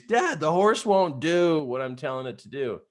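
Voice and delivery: retold frustrated call for help; imitated yell. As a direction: act out complaining loudly through the trees. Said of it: "I'm yelling to the trees".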